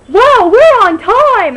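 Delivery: very loud, high, slow shout; loud obnoxious guy. Retold or imitated. Latecomer dad loudly cheering at the theatre door, shouting. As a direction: bellow it out like an oblivious loudmouth. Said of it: "he's just like".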